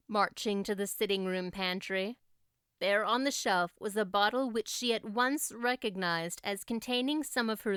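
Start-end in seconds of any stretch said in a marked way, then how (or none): none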